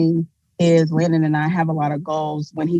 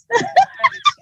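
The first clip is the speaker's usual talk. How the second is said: a sudden high-pitched laughing squeal; high squeal of laughter. Real, not acted. Cousin shrieking with laughter at her own cheeky joke, high squealing laugh. Teasing joke about husband's return.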